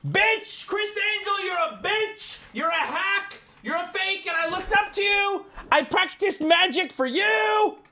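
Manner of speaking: high, strained hammed-up screech; theatrical high-pitched tantrum; aggressive character. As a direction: screech it as a betrayed, wounded fan. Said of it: "That character is more aggressive".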